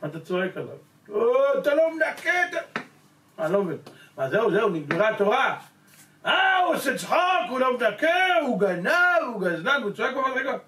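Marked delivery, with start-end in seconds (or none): 1.1-2.8 high, loud scolding of a cleaner; mock angry yell. Building committee man yelling at the stair cleaner, angry. 6.2-10.6 high, loud outraged ranting; mock angry shout. Boss ranting about the cleaner, high loud shout.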